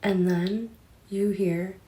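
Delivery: hushed horror-story telling, set up the scare; spooky story narration. Acted. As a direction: hush it down like a campfire ghost story. Reads spooky scenario.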